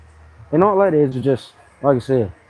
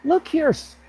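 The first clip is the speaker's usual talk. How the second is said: much higher, put-on voice calling a cousin out; mock blunt friend. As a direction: bark it like teasing a cousin. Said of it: "be like this".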